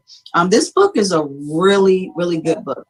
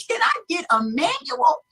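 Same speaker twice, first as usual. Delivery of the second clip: high-pitched, softer mock plea; exasperated mock plea; 11 semitones higher, 5 dB quieter than usual. Overwhelmed first lady begging for instructions, exasperated mock plea. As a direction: squeak out a helpless question on the edge of laughter.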